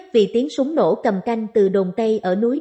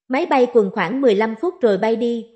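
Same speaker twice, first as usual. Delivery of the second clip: usual speaking voice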